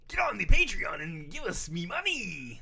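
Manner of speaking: loud, slow, high pirate crab voice; Mister Krabs. Retold or imitated. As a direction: growl it like a greedy sea captain. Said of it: "I was trying to get to Mister Krabs".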